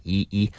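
two short "E" vowels; quick, separate "E" sounds, low-pitched; isolated EE sound. Shown as a demonstration. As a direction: drill the vowel "E" briefly. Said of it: "that sound".

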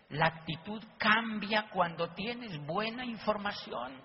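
slow, deliberate repeat of "La actitud cambia". Shown as a demonstration. Speaker drives the point home to the audience, slowly. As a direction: spell it out slowly so it sinks in. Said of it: "Fíjense que la actitud cambia".

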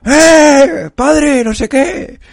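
high shouted call across the street. Retold or imitated. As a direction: call out to the workers opposite. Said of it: "pues de repente digo". (grito agudo llamando desde la ventana)

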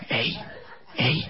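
soft, spaced-out murmur; tender reassurance. Acted. Boyfriend telling girlfriend he loves her, tender reassurance. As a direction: soothe gently, leaving pauses.

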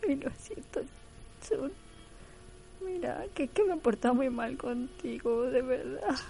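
high, hammed-up tearful apology; mock crying Jin. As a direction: whimper it like a soap-opera sob. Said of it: "se va a echar a llorar el Jin".

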